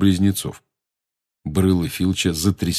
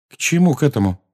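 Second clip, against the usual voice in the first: worried, higher-pitched question; anxious; 6 semitones higher, 3 dB louder, faster than usual. A narrator's reading. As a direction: ask with a touch of alarm. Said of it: "тревожно спросила Гермиона". (тревожно)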